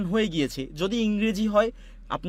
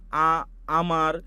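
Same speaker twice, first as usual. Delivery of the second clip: stumbling demo; 2 semitones lower, much slower than usual. Shown as a demonstration. Teacher showing how a voice artist stumbles on a word. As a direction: stammer on the first sound and slowly restart "আ-, আমার". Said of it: "আপনি আটকান এরকম".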